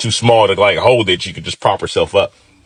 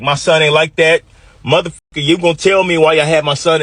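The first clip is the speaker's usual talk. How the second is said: raised-pitch indignant father; retold angry dad; 6 semitones higher than usual. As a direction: defend your son with mild, retold anger. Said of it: "my dad was pissed".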